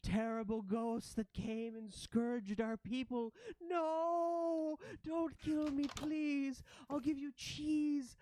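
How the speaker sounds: high ratling wail, building from awe into terror; terrified pleading. Acted. Ratling begs the ghosts not to kill her, terrified pleading. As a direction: beg for your life in a high, wailing voice.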